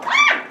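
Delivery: very high, piercing shriek; startled scream. Real, not acted. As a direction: scream in sudden fright. Startled by the bee.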